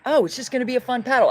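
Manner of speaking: high, quick, breezy quote; chirpy retold optimism, rattled off; mock cheerful optimism. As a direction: imitate your own naive enthusiasm. Retelling her optimism before a hard paddle.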